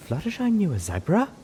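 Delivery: high, surprised question; Velvet Remedy asking. A narrator's reading. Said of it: "Velvet Remedy's voice asked".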